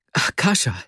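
high, bursting out as he jumps up; excited cry. A narrator's reading. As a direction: call his friend's name twice in a rush. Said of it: "rief Vasja und sprang".

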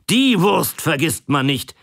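a proud local calling out his sausage. A narrator's reading. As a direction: exclaim it with conviction. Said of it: "rief er".

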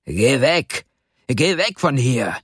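contained storybook shout; angry yell. A narrator's reading. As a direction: snap at the boy to clear off. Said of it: "schrie er den Kleinen an".

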